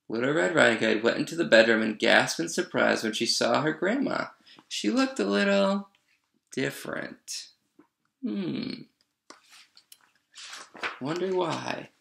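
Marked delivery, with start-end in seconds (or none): 4.7-11.9 high, hesitant, trailing into softness; knowing puzzlement. A narrator's reading. Reader hinting at the disguised wolf, mock puzzled. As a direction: feign wondering, with pauses.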